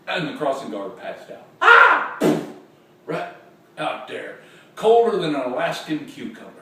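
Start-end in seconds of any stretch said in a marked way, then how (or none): 1.6-2.2 short high, loud yelp; comic high faint shriek; fainting cry. Acted. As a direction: cry out and keel over. Crossing guard passes out.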